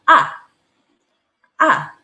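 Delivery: A falling sharply from high; tone four demo. Shown as a demonstration. Teacher modelling falling A for students. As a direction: drop A fast from the top. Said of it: "Itu seperti ini".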